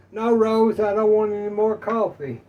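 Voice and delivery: loud, high exasperated answer; husband shouting back. Acted. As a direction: shout the punchline at the wife. Joke punchline, husband's loud answer.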